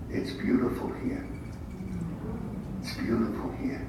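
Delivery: hushed, breathy whisper from beyond; whispered wife's voice from heaven; soft whisper. Retold or imitated. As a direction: whisper it gently, as his late wife. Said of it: "I heard Roseanne's voice in my head say".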